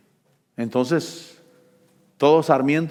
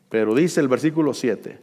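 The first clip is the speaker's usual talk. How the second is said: normal talking voice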